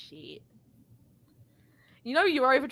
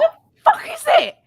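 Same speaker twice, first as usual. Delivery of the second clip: high, loud disbelieving shriek; high squeaky "the fuck"; incredulous squeal; 6 dB louder than usual. Real, not acted. Incredulous outburst.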